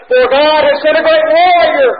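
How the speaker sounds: hammed-up warrior proclamation; mock triumphant proclamation. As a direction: boom it out like a war cry, playfully misquoting. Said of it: "No, he doesn't say that".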